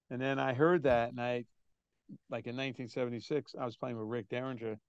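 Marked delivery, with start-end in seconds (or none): none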